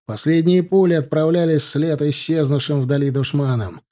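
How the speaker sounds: usual speaking voice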